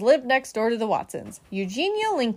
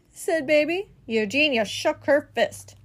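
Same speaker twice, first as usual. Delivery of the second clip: ordinary delivery, nothing marked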